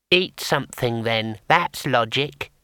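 a small painted imp, unhurried; tiny imp. Acted. Imp settling the matter for the wizard, small creature voice.

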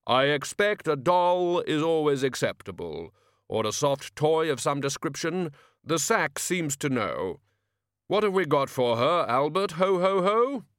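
slow grave voice of Death, cheerfully pleased. Acted. Death chatting to Albert after deliveries, calm and grave. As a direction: speak as Death, mild satisfaction.

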